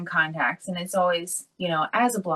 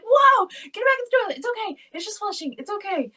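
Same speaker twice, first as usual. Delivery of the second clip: much higher, urgent then hushing; high alarmed reassurance; 11 semitones higher than usual. Retold or imitated. Mom hurriedly soothing her startled son, much higher. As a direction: squeak it out, then calm him. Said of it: "I was like".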